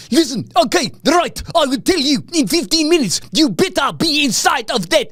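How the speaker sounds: high-pitched mock South African accent; South African accent caricature. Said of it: "sticking up for South African accents".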